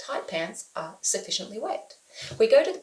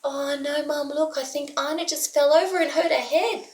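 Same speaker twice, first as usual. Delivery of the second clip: lightly voiced excited little girl, higher; child tattling to mum, a bit higher; little daughter's voice; 7 semitones higher than usual. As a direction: play the kid raising the alarm, pitched up. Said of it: "And she's like".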